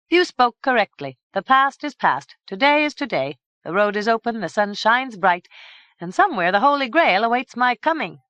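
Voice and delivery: loud, proud knightly swagger; bravado. A narrator's reading. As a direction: puff up with new confidence. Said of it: "recovered something of his bravado".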